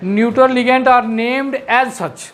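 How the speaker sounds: very slow, word by word English dictation. Shown as a demonstration. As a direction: dictate each word so they can write it. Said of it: "लिखवा दो sir". (छात्रों को धीरे-धीरे notes लिखवाते हुए)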